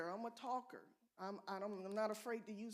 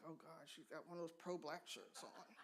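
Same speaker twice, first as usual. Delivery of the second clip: softer, lower mock dismay; imitating a startled colleague; 5 semitones lower, 8 dB quieter than usual. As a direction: mutter it like a man put off by the shirt. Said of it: "stare at my shirt like".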